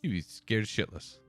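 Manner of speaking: ordinary talk, nothing marked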